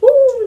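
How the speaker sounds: high, loud, drawn-out whoop; celebratory "woo". Retold or imitated. Wrestler recalls referee dancing in the match, imitated loud high whoop. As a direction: imitate a showman's long wooo.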